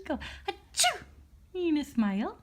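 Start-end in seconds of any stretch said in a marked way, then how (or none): 0.5-1.0 high playful fake sneeze; a big pretend achoo to make him laugh. Dad fakes a sneeze to make baby laugh, high playful. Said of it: "you sneezing that makes every child laugh".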